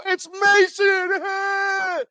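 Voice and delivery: very high, loud, drawn-out cheer; boys calling out. Retold or imitated. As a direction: shout up to a friend, stretching it long. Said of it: "all his boys down there go".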